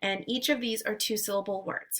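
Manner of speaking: ordinary delivery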